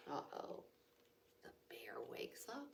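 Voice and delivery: soft, quick whisper; whispered warning that the bear woke; suspense whisper. A narrator's reading. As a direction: hush it like danger is near.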